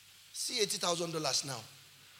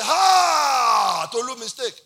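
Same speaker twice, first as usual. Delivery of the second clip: long, loud, high mock gasp-shout; shocked exclamation; 7 semitones higher, 14 dB louder, much slower than usual. Man alarmed at talk of notifying police, loud acted shock. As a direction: draw out a huge alarmed "Ha!".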